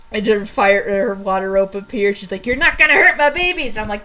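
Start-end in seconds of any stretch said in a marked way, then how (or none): 2.5-3.8 high, loud defiant yell; roused protective shout, played up; fierce battle cry. Thunder defends her babies from the beast, fierce and loud.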